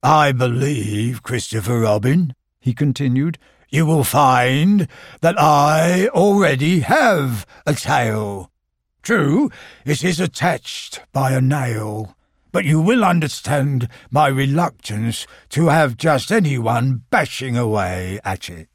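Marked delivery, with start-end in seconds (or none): 0.0-2.3 gloomy Eeyore. Acted. Eeyore voiced in story. 3.7-18.7 gloomy Eeyore. Acted. Eeyore voiced in story.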